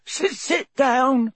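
high, drawn-out tearful elf wail. A narrator's reading. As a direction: wail it slowly in a squeaky voice. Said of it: "he wailed".

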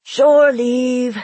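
loud, high joyful shout. Acted. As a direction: shout it to the whole ship. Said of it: "he shouted".

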